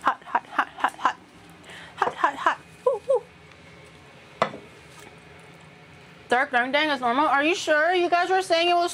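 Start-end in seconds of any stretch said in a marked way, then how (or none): 0.0-2.6 breathy hot hot yelps; burning fingers. Real, not acted. Handling hot rice. 2.8-4.5 high-pitched woo woo. Real, not acted. Reacting to hot rice.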